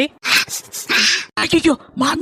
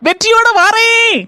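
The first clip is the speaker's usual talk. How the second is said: triumphant promise; 8 semitones higher, 4 dB louder, slower than usual. Acted. Daughter-in-law leaving on a mission.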